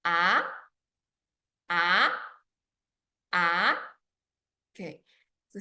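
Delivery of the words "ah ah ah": "Ah" rising from middle to high; short "Ah" climbing sharply at the end; second tone. Shown as a demonstration. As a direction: sweep each "Ah" upward like a question. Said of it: "It starts middle and goes up high".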